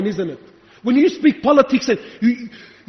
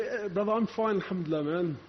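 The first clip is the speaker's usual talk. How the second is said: understated mimicry of a laid-back brother; complacent brother; 2 semitones lower, 8 dB quieter than usual. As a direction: play a comfortable believer, casually pleased with himself. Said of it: "you thought".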